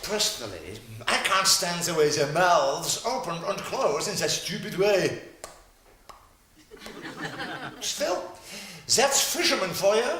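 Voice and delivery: put-on German accent. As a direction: play a pompous German doctor. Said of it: "psychiatrist Doctor Heinz von Meatball".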